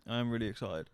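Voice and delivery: ordinary talk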